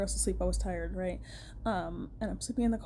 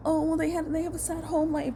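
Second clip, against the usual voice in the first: mock excuse-making; 6 semitones higher than usual. Mimics people excusing bullies.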